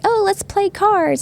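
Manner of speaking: put-on high, slow, cheerful kid voice; Pete. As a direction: sing it out like an eager little boy. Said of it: "Pete's like".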